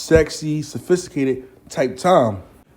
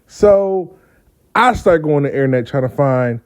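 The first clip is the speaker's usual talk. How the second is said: ordinary talk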